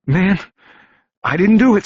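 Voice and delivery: high, loud protest of innocence; yelled denial. Acted. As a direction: shout it out, panicked. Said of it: "Stokes yelled".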